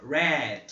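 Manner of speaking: red said with an open E like é; teacher showing the E in red; English word demo. Shown as a demonstration. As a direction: model red with the é sound. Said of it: "ele tem esse som também".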